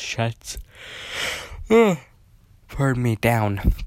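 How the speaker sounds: speaking through a yawn. Real, not acted. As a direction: yawn out the words sleepily. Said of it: "I do apologize for the yawns".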